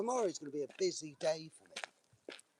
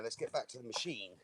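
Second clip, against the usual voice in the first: usual speaking voice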